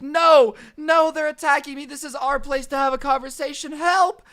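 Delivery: imitating a hysterical Karen; mock hysterical scream. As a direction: scream it like a faked victim. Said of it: "she's like".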